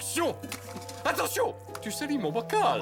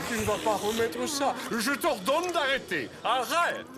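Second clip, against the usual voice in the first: commanding; 6 semitones higher than usual. Acted. Ordering the monster to stop.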